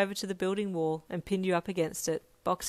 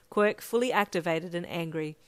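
ordinary delivery

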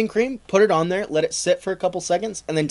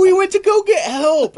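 high, louder, laughing fake innocence; guilty sailors' excuse; 4 dB louder than usual. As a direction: squeak it out like caught kids. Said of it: "And they're like".

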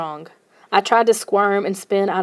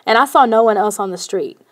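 usual speaking voice

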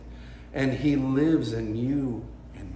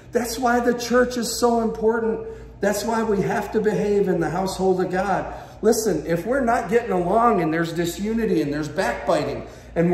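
impassioned preaching, raised voice; passionate; 5 semitones higher, 4 dB louder than usual. Real, not acted. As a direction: press the point home to the congregation. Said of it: "saying this so passionately".

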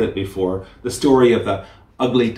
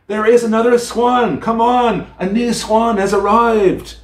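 put-on much higher, louder gleeful children's shout; joyous children shouting; 11 semitones higher, 5 dB louder than usual. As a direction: shout with joy like kids who spotted a new swan. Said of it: "shouting joyously".

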